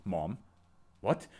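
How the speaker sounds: normal talking voice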